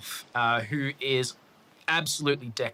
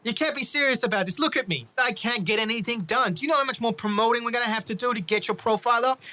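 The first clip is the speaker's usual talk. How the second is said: indignant rant; 8 semitones higher, faster than usual. Acted. George rants at the fighter.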